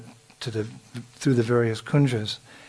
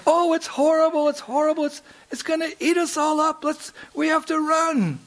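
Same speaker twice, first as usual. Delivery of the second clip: mock terrified cry. Mother fooling the boys, high hammed-up fright. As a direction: cry out in pretend terror, much higher. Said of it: "she cried out".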